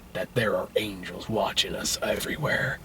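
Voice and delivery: low, very soft mock-profound voice; near-whispered reverent delivery; hushed solemn murmur. Joe tells the girl about angels, hushed solemn.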